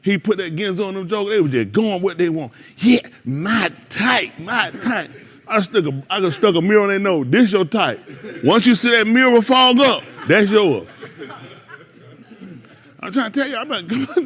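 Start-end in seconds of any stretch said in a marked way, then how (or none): 2.8-5.1 slow, savoring mock inspection; slow acted drawl. Acting out mirror-checking suitor. 7.3-10.9 loud acted declaration. Acting out the mirror check.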